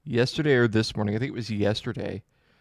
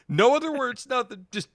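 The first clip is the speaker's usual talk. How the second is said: usual speaking voice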